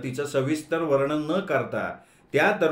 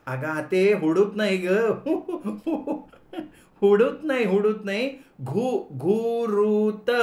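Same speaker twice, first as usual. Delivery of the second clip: laughing correction; 7 semitones higher, slower than usual. Acted. Girl laughingly corrects aunt.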